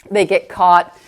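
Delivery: ordinary talk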